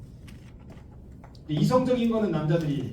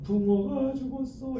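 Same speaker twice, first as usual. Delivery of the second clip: put-on crying child; 6 semitones higher, slower than usual. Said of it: "그랬더니 아들이".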